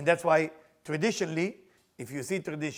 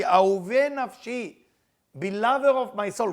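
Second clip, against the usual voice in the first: tender greeting; 4 semitones higher, 8 dB louder, slower than usual. Acted. God tenderly greets his people.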